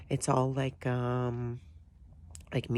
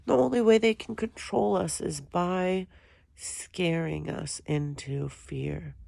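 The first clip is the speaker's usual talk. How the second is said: ordinary talk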